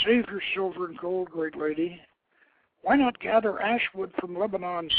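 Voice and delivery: put-on proud young hero turning down an offer; young prince Akkad, lightly voiced. Young prince politely refusing the goddess's bargain.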